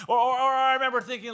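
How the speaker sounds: animated excited telling; excited rush. Real, not acted. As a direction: rush it out with energy. Excited storytelling burst.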